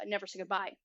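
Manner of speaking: ordinary delivery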